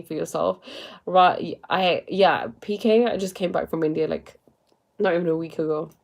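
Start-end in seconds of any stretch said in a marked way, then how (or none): none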